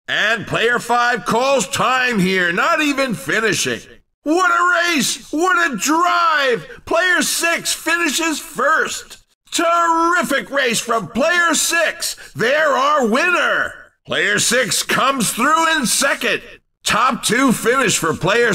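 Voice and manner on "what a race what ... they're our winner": excited sports-announcer cry; thrilled winner call. Acted. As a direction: marvel at a great drive. Announcer calls the race winner.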